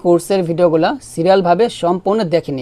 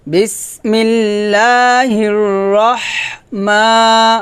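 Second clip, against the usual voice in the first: slow recitation; 3 semitones higher, much slower than usual. Shown as a demonstration. Recites the joined phrase.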